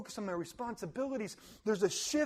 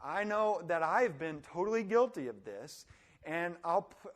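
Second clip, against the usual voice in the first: normal talking voice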